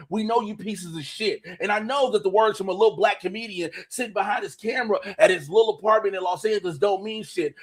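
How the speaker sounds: ordinary talk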